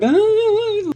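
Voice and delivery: sudden high, loud squeak of surprise; startled gasp. Retold or imitated. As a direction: imitate her caught-off-guard yelp. Girl confronted, gasps and runs.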